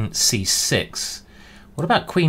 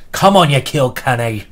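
loud, hammed-up fake Irish accent cheering the opening; a cheering Irish fan, badly faked, louder; 6 dB louder than usual. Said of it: "Very bad Irish accent there".

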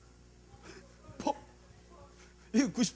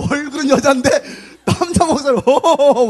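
laughing; 7 semitones higher, 6 dB louder than usual. Real, not acted. Laughing at a deep voice.

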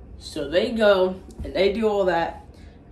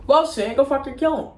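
raised, pitched-up bossy order; higher mock command; imitating Saddam, higher; 7 semitones higher, 3 dB louder, faster than usual. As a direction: push the order out high. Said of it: "Saddam Hussein's like".

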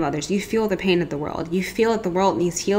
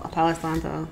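normal talking voice

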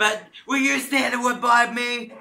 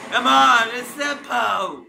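loud "Come on", then slow "it's simple"; frustrated shout; 7 dB louder, slower than usual. Real, not acted. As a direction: yell in frustration at the game. Frustrated gamer yelling at game.

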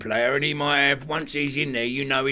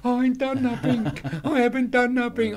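crying man; 10 semitones higher than usual. Retold or imitated. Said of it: "He's crying".

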